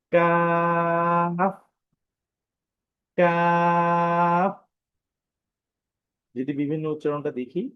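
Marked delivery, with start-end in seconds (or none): 0.1-4.7 কাফ with a thin aa, held very long; thin-sounding letter. Shown as a demonstration. Teacher repeats the letter for learners, thin, drawn-out কাফ. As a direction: stretch each কাফ thinly on a level tone. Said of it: "automatically পাতলা হয়ে যায়".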